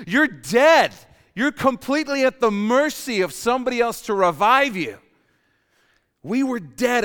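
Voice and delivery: loud, high, forceful sermon delivery; impassioned preaching. Acted. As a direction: drive it home to the congregation, building to a shout.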